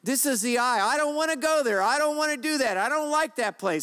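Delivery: high, loud mock-selfish whine; hammed-up petulant selfish voice; whiny selfish voice. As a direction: act out a selfish person whining. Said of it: "Selfish ambition".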